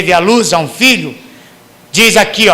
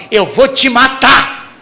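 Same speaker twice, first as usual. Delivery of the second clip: mock rage, much higher and louder on the middle; furious parent threat; 7 semitones higher, 6 dB louder than usual. Parent threatening a misbehaving child, hammed-up furious shout. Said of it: "que você não fala".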